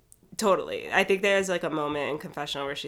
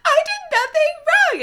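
tantrum wail; 14 dB louder than usual. Retold or imitated. Ashley melts down at the ambulance, wailing high and crying. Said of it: "throwing a tantrum".

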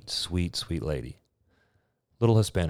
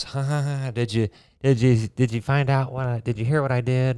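giggly technician; 7 semitones higher, 4 dB louder than usual. Retold or imitated. Technician laughing about her mistake.

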